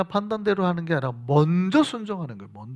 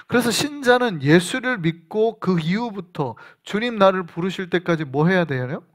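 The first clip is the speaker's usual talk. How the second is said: usual speaking voice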